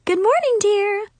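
high, bright chirpy greeting; chirpy mom. A narrator's reading. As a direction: chirp it sweetly like a cheerful mom. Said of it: "Mom chirped".

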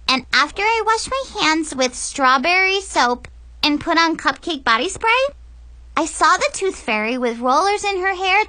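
put-on high, chattering kid voice; excited little girl. Brianna tells Dad about the tooth fairy, high. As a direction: tell it like a proud little kid.

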